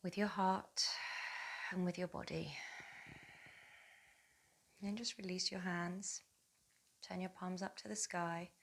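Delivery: soft, unhurried meditation voice with long pauses; guided meditation. Acted. Yoga teacher connecting mother and baby through breath, soft guided meditation. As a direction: guide them gently through each breath, softly.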